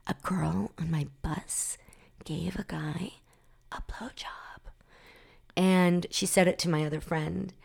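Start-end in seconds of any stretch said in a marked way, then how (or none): 0.1-4.6 scandalized gossip whisper, broken into pieces. Retold or imitated. Girl whispers bus gossip to a friend, whispering. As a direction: whisper it haltingly, almost voiceless. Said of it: "whispered to another girl".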